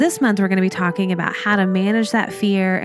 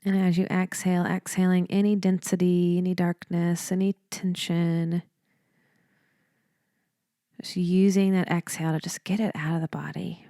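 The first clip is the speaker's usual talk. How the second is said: softer, unhurried meditation voice with long pauses; guided meditation; 7 dB quieter than usual. Real, not acted. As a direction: lead them gently through each breath, leaving space between phrases.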